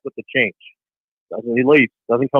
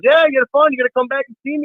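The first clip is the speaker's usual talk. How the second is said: lightly voiced Pee Wee Reese, higher; a hint of a friendly ballplayer; 9 semitones higher, faster than usual. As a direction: kind star asking a kid. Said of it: "He goes".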